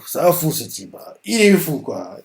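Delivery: ordinary talk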